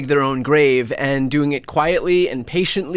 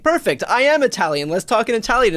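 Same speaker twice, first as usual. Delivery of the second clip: higher, bright mock enthusiasm; mock-cheerful offer to talk in Italian; eager reply; 7 semitones higher than usual. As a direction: chirp it like a delighted Italian speaker. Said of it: "And they're like".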